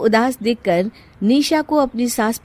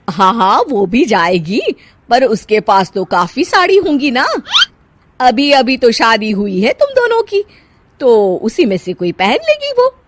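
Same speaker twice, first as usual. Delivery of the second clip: wicked stepmother; 4 semitones higher, 6 dB louder than usual. Acted. Mother-in-law dismissing request.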